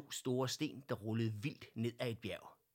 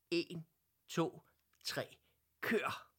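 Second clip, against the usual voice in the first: eager countdown; 8 semitones higher, 3 dB louder, slower than usual. Acted. Counting down before the ride.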